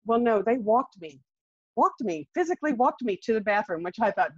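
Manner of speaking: ordinary delivery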